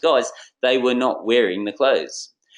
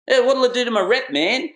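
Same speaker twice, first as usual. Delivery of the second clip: much higher, louder anxious whine; mock worried salesman; 12 semitones higher, 4 dB louder than usual. Salesman worrying about his mates' opinion, hammed-up whine. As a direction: play the fretting cool kid. Hip-hop salesman voiced refusing clothes.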